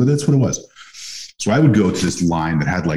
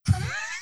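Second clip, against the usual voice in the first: a quick high giggle, much softer; short high laugh; 10 dB quieter than usual. Real, not acted. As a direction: laugh at your own cheeky line. Laughs after his quip.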